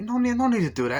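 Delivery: very high, loud panicked plea; frantic protest. Retold or imitated. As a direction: squeal it out in mock alarm. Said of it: "he's like".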